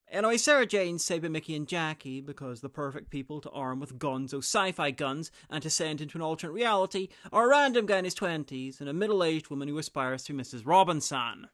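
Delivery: ordinary delivery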